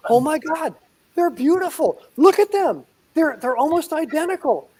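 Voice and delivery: gushing delight. Retold or imitated. Seatmate gushing at the hidden sugar gliders, high excited squeal. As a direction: squeal over something adorable.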